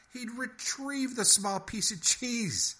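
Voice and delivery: squeaky incredulous echo; incredulous mouse. A narrator's reading. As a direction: echo it in disbelief. Henry repeating incredulously.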